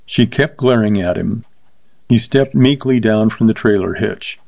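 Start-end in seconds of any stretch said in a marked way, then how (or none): none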